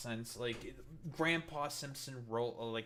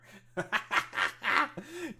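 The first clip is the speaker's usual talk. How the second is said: loud, high cackling laugh; real laugh; 11 semitones higher, 7 dB louder than usual. Real, not acted. Commentator cracking up at an absurd claim, cackling. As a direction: burst out cackling in disbelief.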